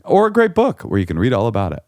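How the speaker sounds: normal talking voice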